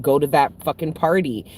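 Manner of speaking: ordinary delivery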